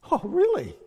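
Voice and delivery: high, loud "oh, really?"; acted amazement; surprised. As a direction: jump up in pitch as if astonished. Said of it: "Should we be surprised?".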